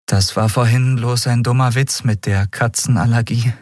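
put-on tentative young man's apology; cautious smile. A narrator's reading. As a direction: smile cautiously while smoothing over a joke. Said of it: "versicherte Miko mit vorsichtigem Lächeln".

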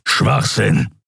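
gruff, angry scoff; growled dismissal. A narrator's reading. Kero dismisses Kai's claim, growling. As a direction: growl it in contempt. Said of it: "grollte Kero".

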